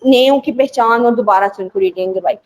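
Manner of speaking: ordinary talk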